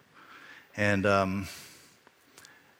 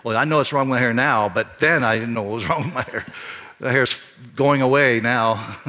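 ordinary delivery

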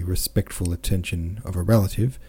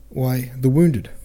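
pleading; 5 semitones higher, 4 dB louder, slower than usual. Acted. Natasha pleading with mother.

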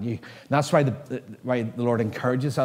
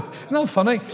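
usual speaking voice

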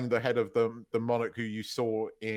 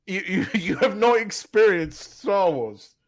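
loud, slow, high and laughing; drawn-out mock-grand declaration through laughs; laughing proclamation; 6 semitones higher, 6 dB louder than usual. Laughing parody of a grand film quote.

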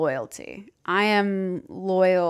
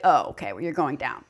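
a lower, softer vow of revenge; low cold threat; 6 semitones lower, 3 dB quieter than usual. Retold or imitated. To someone who crossed her, low quiet menace. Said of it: "be like".

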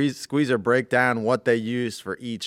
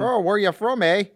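high, put-on North Dakota accent; 9 semitones higher, 4 dB louder than usual. Co-host mimicking actors picking random accents, North Dakota accent. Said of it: "like, a North Dakota accent".

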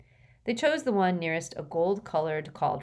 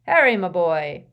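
jovial professor; 11 dB louder than usual. A narrator's reading. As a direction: boom out instructions cheerfully. Slughorn's voice.

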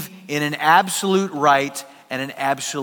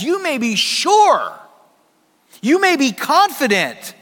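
higher and louder, slow, driving each word; fervent preaching; 8 semitones higher, 5 dB louder than usual. Acted. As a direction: preach it out with full conviction. Preaching peak.

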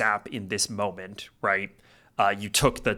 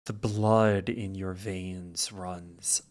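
slow, soft, ominous narration; hushed storyteller building dread; game master's scene setting; 3 semitones lower, 5 dB quieter, slower than usual. Acted. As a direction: draw it out quietly, with long pauses.

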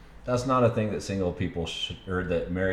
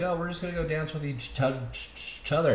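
imitating his wife; 8 semitones higher, 3 dB quieter than usual. Husband retells his wife's club excuse, imitating her. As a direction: lightly mimic her in a raised pitch. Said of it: "She's like".

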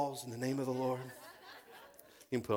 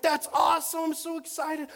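very high, loud enthused outburst; re-enacted squeal of delight; hammed-up excitement; 9 dB louder than usual. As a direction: gush it at the top of your range. Re-enacts excited reply to small crowd.

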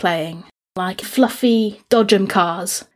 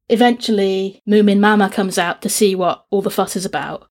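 ordinary delivery

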